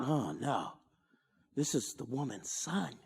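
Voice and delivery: quiet voice of sudden realisation; hushed inner thought. Retold or imitated. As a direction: murmur it as Jesus realising the loss.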